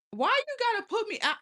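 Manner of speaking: high, loud and fast, hurt protest. Real, not acted. Whining at chat for putting her on the spot. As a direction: squeal it out like you're being ganged up on.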